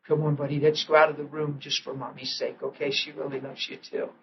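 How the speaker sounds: put-on soft, gentle voice to a small child; tender coaxing. Father gently leading his son out of the room, soft. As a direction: coax him out quietly.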